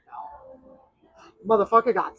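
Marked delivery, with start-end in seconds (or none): 0.1-0.8 whispered aside. Retold or imitated. Date's quiet dig at him.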